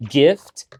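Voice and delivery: Gift with a crisp hard T; Gift, T punched clearly; stressing the T. Shown as a demonstration. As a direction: hit the T in Gift. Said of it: "Gift with a fucking T".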